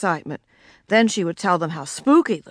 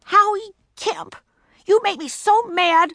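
angry shout; 13 semitones higher than usual. Acted. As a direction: shout in frustration. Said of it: "shouted Ramona".

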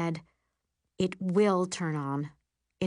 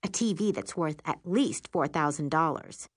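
ordinary delivery, nothing marked

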